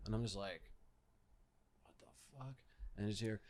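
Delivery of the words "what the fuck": barely audible, under-the-breath reaction; hushed bewilderment. Retold or imitated. Storyteller replaying being woken by screaming at night, hushed bewilderment. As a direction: say it tiny and stunned.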